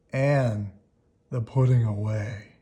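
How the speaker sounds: ordinary delivery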